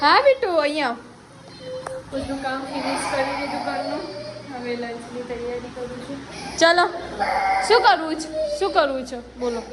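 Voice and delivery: excited mother cooing at her little one. Real, not acted. Playful talk to the baby.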